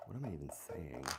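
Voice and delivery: whispered aside; whispering. Real, not acted. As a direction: whisper it to yourself.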